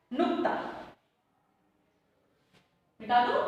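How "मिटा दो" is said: ordinary talk, nothing marked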